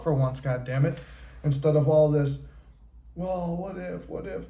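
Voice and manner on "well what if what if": higher and much softer, a fretful mock murmur; mock worried what-ifs. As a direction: play the anxious overthinker, half under the breath. Mocking the anxious what-if thinking.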